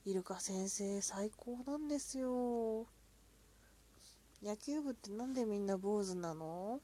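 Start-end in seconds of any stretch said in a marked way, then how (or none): none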